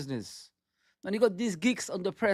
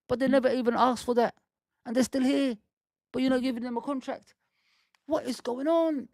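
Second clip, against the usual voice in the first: rising, higher, more and more worked up; a fan's voice climbing in disbelief; heated rant; 7 semitones higher than usual. Real, not acted. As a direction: plead the case, getting higher and more upset. Fan ranting about unsigned contracts.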